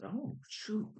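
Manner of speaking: mock dismay. Cashier's dismay, retold.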